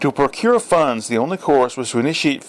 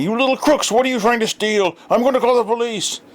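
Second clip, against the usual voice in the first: high, strained mock shout at kids; angry concierge shouting; 11 semitones higher than usual. A narrator's reading. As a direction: chase the boys off, scolding.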